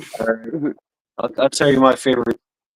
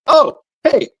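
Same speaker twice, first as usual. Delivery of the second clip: high, cheery oh hey; mock-welcoming bartender; bartender greeting; 13 semitones higher, 4 dB louder than usual. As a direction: greet the customers brightly.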